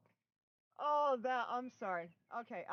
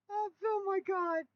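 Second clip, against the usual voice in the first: laughing; 9 semitones higher, 6 dB louder than usual. Real, not acted. Bursting into laughter on live.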